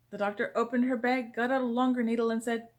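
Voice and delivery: usual speaking voice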